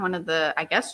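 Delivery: ordinary delivery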